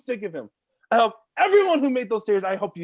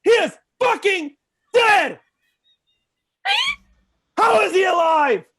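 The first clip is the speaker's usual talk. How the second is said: furious fan yelling in disbelief; outraged shout; 9 semitones higher, 5 dB louder than usual. Real, not acted. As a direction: shout it like the plot betrayed you.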